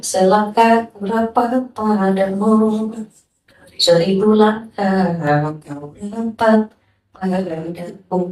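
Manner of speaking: slow, deliberate reading; slow solemn recitation. Real, not acted. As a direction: let each phrase land slowly. Membacakan hadis pelan. (dibacakan pelan dan khidmat)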